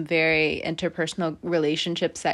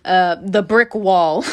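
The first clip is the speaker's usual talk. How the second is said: slow, punchy and louder; branding a phrase; 6 dB louder, slower than usual. Real, not acted. Reader naming her pet term for a lesson, slow and punchy. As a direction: land "the brick wall" like a catchphrase. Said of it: "or as I like to call it".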